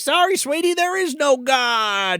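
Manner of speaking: high, drawn-out hammed-up villain taunt; mock taunt. Captor answering the pleading victim, mock taunt. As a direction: put on a high voice, stretch the last words.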